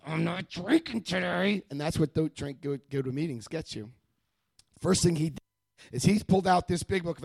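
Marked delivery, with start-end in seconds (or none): 0.1-1.6 slow, strained vow, imitating desperate resolve. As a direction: force it out as if barely holding on.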